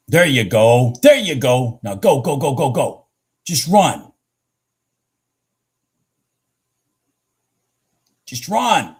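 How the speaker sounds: loud excited urging; cheering on. Real, not acted. Urging trade on screen.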